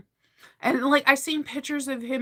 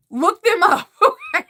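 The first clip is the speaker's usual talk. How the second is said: louder, laughing through the words; laughing plea; 10 semitones higher, 9 dB louder than usual. Real, not acted. Urging her viewers to look up the band, laughing loudly. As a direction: urge them on while you laugh.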